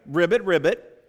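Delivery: loud ribbit ribbit; frog croak. Shown as a demonstration. Leader coaxing the frog group, loud croaking ribbit. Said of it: "a little bit louder".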